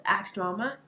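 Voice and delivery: ordinary delivery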